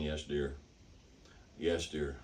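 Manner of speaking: low, soft, absent-minded agreement; mumbled assent from behind a newspaper; half-listening husband placating his wife; tuned-out husband. Acted. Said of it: "He's completely tuned her out".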